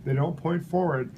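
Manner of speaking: normal talking voice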